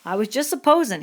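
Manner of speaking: backing off. A narrator's reading. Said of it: "Crooks saw the danger".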